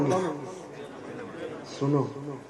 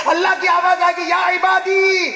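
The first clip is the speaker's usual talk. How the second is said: divine summons; 5 dB louder than usual. Acted. Allah summoning his servants on Judgement Day, proclaiming. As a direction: call out with a long held ending. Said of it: "اللہ کی آواز کیسی ہوگی".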